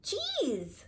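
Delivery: high excited lamb cry. Acted. Lamb excitedly telling coyote about cheese. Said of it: "cried Boragita".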